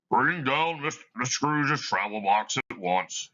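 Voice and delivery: a hint of a stern schoolmaster; mean schoolmaster offstage. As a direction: call out sternly from the hallway. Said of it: "a mean and terrible voice in the hallway".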